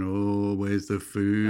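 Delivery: slow groaning zombie; zombie impression. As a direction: moan it like a hungry zombie. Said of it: "my kind of impression of a zombie".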